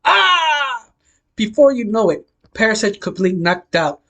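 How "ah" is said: short, high-pitched acted scream; storyteller's scream of pain. A narrator's reading. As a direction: shriek high, sliding downward. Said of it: "Just hearing Parasite scream".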